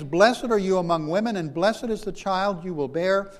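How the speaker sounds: Elizabeth's greeting cried out loud; loud exclaiming. Acted. Preacher voicing Elizabeth greeting Mary, exclaimed loudly. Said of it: "in a loud voice, she exclaims".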